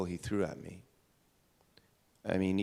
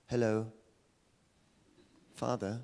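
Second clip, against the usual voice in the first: put-on higher, proper little British boy's accent; mock-British child greeting his father; full-on British accent; 3 semitones higher than usual. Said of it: "by that age, I was, like, full on".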